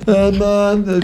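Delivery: high, drawn-out announcer call; announcer echo. Shown as a demonstration. Host repeats the demo, high announcer voice.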